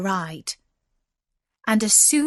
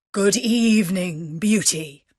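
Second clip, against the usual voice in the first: voiced beast; 3 dB louder than usual. A narrator's reading. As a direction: greet her as the beast, heavy and measured.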